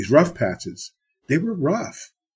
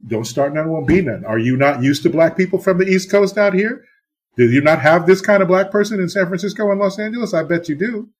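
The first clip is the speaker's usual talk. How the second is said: rising, louder challenge to the crowd; provoking the West Coast listeners, higher and louder; defiant challenge; 7 semitones higher, 4 dB louder than usual. Real, not acted. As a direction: call them out, building up high and loud.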